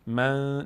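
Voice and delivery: من ending in a held nasal hum; nasal held noon. Shown as a demonstration. Teacher showing where the noon sounds, nasal hum on من. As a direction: let the ن of من ring in the nose. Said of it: "صدا تو بینی داره میاد".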